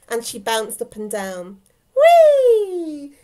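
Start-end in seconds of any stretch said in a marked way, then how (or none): 2.0-3.1 loud, high, long whee, sliding down; gleeful whee. A narrator's reading. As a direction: squeal a giggly, joyriding whee. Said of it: "she chuckled".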